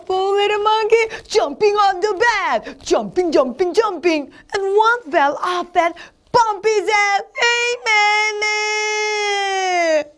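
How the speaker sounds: high, excited, drawn-out storybook voice; animated reading that ends in a wail; dramatic storytelling. Shown as a demonstration. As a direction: act out the tumble with mock alarm. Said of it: "이렇게 실감나게 재밌게 해야지".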